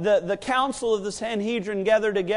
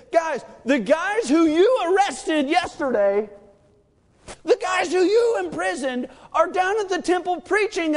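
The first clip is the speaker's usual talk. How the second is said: higher, slowed, urgent announcement; excited report; 7 semitones higher than usual. Retold or imitated. Messenger announcing the escaped apostles, excited shouting. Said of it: "this guy jumps into the scene".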